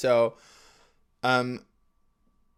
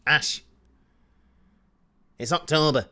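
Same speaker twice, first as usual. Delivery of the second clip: lightly voiced strict mother, higher; strict mum; 4 semitones higher, 5 dB louder than usual. Mum instructing her child about the Christmas list, mock strict. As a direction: imitate a mum laying down the law. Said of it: "my mum was quite strict with it".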